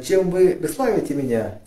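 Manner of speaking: ordinary talk, nothing marked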